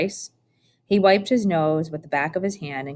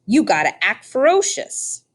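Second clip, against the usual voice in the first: put-on higher, louder rustic old woman voice; coaxing old woman; 6 semitones higher, 4 dB louder than usual. A narrator's reading. Old woman tells the scarecrow rabbit to act fierce, coaxing. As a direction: egg him on like a bossy farm granny.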